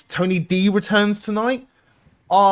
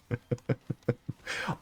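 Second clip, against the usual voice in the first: soft, low chuckle; stifled laugh; 5 semitones lower, 14 dB quieter than usual. Real, not acted. Streamer amused by talk of Australia, quiet laugh.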